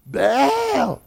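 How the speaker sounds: pitched well up, crying out to Baal; high call to Baal. Acted. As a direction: call desperately to your god. Prophets calling out to Baal.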